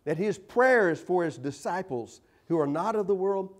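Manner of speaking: usual speaking voice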